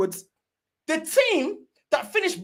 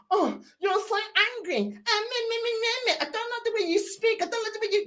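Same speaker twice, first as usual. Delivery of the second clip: mock whiny critic; 6 semitones higher, 4 dB quieter, faster than usual. Mocking online critics.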